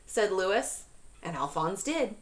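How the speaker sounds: ordinary talk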